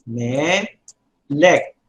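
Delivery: "Melek" drawn out as meh, then lek; syllable by syllable. Shown as a demonstration. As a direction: split "Melek" slowly into two separate syllables. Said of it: "notice how you pronounce it".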